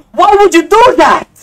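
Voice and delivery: high, loud disbelieving cry; shouting at the auction seller in disbelief, high and loud; incredulous shout. Real, not acted. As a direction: yell it in outraged disbelief. Seller undersells a Skyline.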